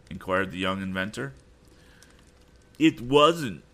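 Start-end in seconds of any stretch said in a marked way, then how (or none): 2.8-3.6 higher and louder indignant outcry; cried out. A narrator's reading. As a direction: blurt the denial hard. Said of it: "cried Sam".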